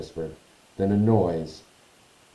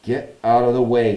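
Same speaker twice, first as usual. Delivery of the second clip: louder irritated snap; snapping; 3 semitones higher, 7 dB louder than usual. Acted. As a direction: snap it impatiently. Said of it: "snapped Ron".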